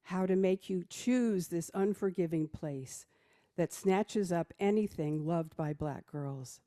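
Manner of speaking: normal talking voice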